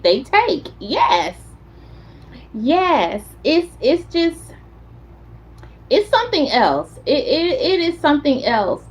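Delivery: ordinary talk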